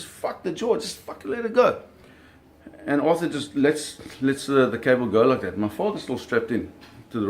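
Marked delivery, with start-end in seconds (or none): 0.0-1.8 high, exasperated retold yell. Father ordering Arthur to forget the jaw, exasperated high yell. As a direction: snap at him to drop it, pitched way up. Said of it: "My dad says".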